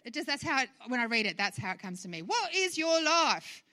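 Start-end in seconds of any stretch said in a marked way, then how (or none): 2.2-3.6 slow, loud, high British declamation; British accent. Shown as a demonstration. Preacher showing how the verse sounds to her, loud British accent. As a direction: proclaim it grandly in a British accent. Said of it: "say that in a British accent".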